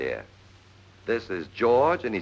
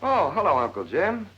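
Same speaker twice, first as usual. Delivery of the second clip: surprised; 12 semitones higher, 4 dB louder than usual. Acted. Startled greeting, uncle appears.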